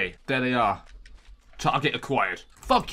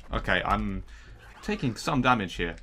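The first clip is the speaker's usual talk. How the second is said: normal talking voice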